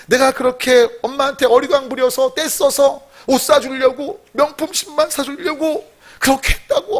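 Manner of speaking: high, choked-up remorseful son; acted weepy apology; acted crying. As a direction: plead tearfully with mom. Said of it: "엄마 앞에서 울면서".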